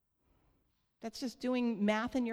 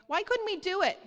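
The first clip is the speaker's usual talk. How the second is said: high, loud baffled plea; exasperated question; 8 semitones higher, 7 dB louder than usual. Acted. Disciples asking Jesus why they failed, exasperated. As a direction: blurt it out as a baffled complaint.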